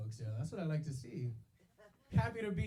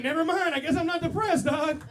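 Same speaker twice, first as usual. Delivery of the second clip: loud, higher mock yell; mock shout; 8 semitones higher, 10 dB louder than usual. After it hurt, loud mock shout. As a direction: blurt it out loudly in giving up. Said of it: "I was like".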